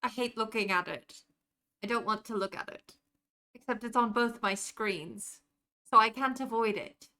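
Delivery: a put-on British accent held through casual stream chat. Streamer doing a five-minute British accent for a chat redeem, British accent. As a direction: keep the British accent going, trying not to drift. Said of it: "holding this accent better than I typically do".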